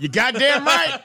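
high, loud drawn-out cheer; triumphant. Real, not acted. Co-player cheering an Elijah Wood pick, triumphant. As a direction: crow it slowly at full voice. Elated answer in game banter.

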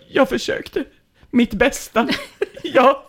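halting, high-pitched mock stammer. Trying to explain to the crying girlfriend, acted stammer. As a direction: break it up with pauses, hammed-up and pitiful. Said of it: "Jag stammar och försöker förklara".

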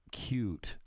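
ordinary talk, nothing marked

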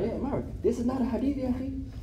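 soft mock whisper to a friend; whispering to Myra. Student whispering doubt to Myra. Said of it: "you're whispering to Myra".

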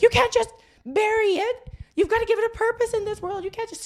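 high, fast insistent hippie lady; crazy lady. Retold or imitated. Crazy hippie lady scolding the girls, high and insistent. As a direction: push it like a zealous hippie. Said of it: "this one crazy lady's like".

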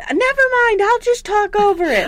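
loud, high mock holler; shouting dad. Deaf dad giving up on pausing TV, mock shouting. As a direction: shout over the noise.

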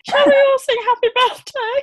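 high, cheery assembly teacher voice; sing-song invitation to the whole hall; teacher announcing. Retold or imitated. Teacher announcing in retold story.

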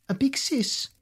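high surprised echo; incredulous. Acted. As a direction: squeak it in disbelief. Boy echoes in disbelief.

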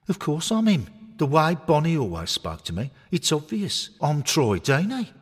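high, loud, cocky toy voice; deluded knitted footballer. Acted. Knitted Troy insisting to Harry he's Troy Deeney, high and cocky. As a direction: puff up and claim you're the real star.

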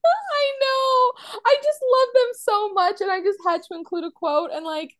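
real high-pitched, tearful gushing; choked up. Real, not acted. Fan overwhelmed by a sweet quote, high and tearful. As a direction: gush as if about to cry, voice way up. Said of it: "I just couldn't take it".

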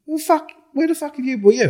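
hammed-up furious mate demanding answers; imitating an angry mate. Mate Paul angrily asking where he's been, mock yell. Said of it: "Paul going".

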